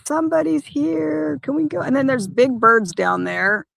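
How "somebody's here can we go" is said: a hint of an eager dog, higher; mildly higher, eager pup voice; lightly voicing the dog. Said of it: "She's just like".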